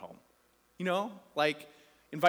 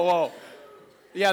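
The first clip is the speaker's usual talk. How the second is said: usual speaking voice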